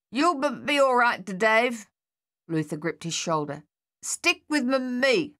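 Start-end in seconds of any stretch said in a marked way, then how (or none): 0.1-1.9 higher stuttering soldier voice; stammering reassurance. A narrator's reading. Luther steadying Dave under shellfire, stammering. 4.0-5.3 higher voice catching on a word; stutter, then push the word out; stammering urging. A narrator's reading. Luther keeping Dave close in the trench, stammering.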